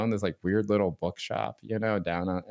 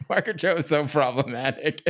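laughing; 8 semitones higher, 4 dB louder than usual. Real, not acted. Laughing admission about favourite comic.

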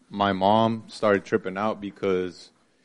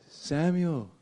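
sweet-talking mom; 6 semitones higher than usual. Retold or imitated. Mom sweetly offering food next day.

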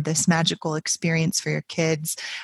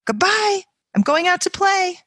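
put-on higher, dramatic sick-child complaining; Peggy Ann whining sick; 5 semitones higher than usual. Little girl faking illness to skip school, hammed-up whiny.

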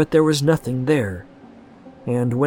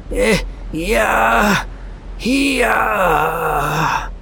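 blood-curdling scream; 9 semitones higher, much slower than usual. Acted. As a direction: scream in pure terror. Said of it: "blood-curdling scream".

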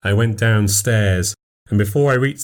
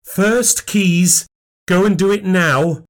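commanding; 11 semitones higher, slower than usual. A narrator's reading. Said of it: "Katya commanded".

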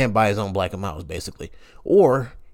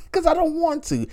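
high exclamation. Retold or imitated. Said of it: "repeated refusal".

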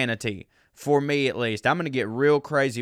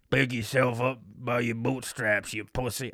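put-on Southern accent quote. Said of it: "that's not a Southern accent person".

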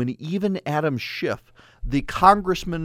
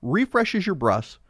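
ordinary talk, nothing marked

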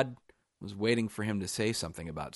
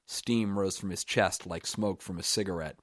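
ordinary delivery

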